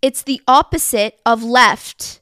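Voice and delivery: loud, slow exasperated answer; drawn-out, fed-up emphasis; annoyed. Retold or imitated. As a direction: spell it out as if to someone hopeless. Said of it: "very obviously annoyed".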